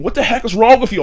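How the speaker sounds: half-shouted what the heck; mock yelling question. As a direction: throw it at him like a baffled roommate. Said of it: "I got people saying".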